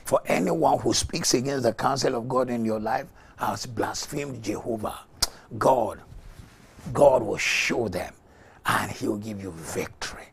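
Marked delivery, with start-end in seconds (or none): none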